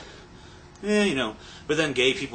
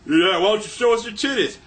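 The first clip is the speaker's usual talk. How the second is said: higher, put-on party cameraman voice; egging-on cameraman; 7 semitones higher, 4 dB louder than usual. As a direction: goad the crowd as a sleazy cameraman.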